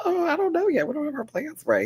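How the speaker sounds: high coy sing-song, playing hard to get. Brushing off the stripper's invitation, coy and high. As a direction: act innocent and a little flirty.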